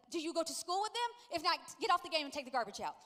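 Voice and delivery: loud mock-scolding mother; nagging mom impression. Mom ordering son off the game, mock-stern. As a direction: rattle off orders, exaggerated. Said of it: "he says that I sound like this".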